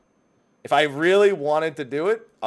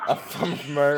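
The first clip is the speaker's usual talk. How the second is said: laughing mimicry; faster than usual. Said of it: "mocking the Mary impression".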